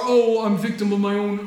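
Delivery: mock self-pity. Mocking a self-pitying sinner, lightly whiny. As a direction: ham up the self-pitying victim. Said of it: "Here you are".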